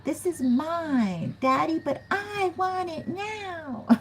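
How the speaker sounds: high, slow, whiny spoiled voice; hammed-up bratty pout; bratty whine. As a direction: whine it like a spoiled child begging daddy. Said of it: "a woman who's like".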